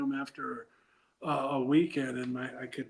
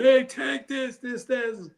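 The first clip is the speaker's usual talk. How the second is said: excited fan calling; 8 semitones higher, 5 dB louder than usual. Retold or imitated. Fans crowding him for autographs.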